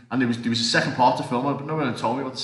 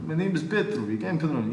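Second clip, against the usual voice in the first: higher-pitched put-on Russian accent; Petrovic, Russian accent; 5 semitones higher than usual. Actor auditioning on the spot for director, Russian accent. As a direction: introduce yourself in a heavy-handed Russian accent. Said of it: "can you do a Russian accent".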